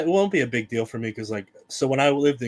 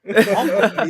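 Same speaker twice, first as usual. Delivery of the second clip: ordinary talk, nothing marked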